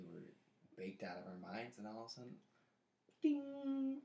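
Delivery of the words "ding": high loud ding; ding sound. Retold or imitated. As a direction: chime like a lightbulb moment. Sudden realization sound effect.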